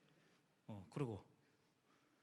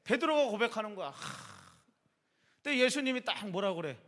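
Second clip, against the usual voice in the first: normal talking voice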